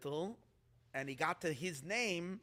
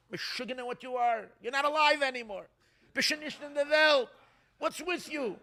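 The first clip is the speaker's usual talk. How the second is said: higher and louder, an exasperated scold; scolding Rebbe; 8 semitones higher, 8 dB louder than usual. Retold or imitated. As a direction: tell off a foolish man, loud. Rebbe scolds the dead man.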